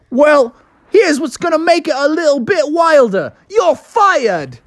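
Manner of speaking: Australian boss questioning his late driver. Acted. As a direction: scold him like an Aussie boss. Dingo Dan fires Bubby.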